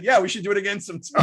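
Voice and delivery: quick, laughing comeback; laughing, fast and playful; laughing reply. Retold or imitated. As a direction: toss it back with a laugh. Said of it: "I was like".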